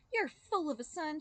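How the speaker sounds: high, loud accusing wife; crying out. Acted. Wife accusing the boy's father, high and loud. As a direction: hurl it at the father. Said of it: "she cried".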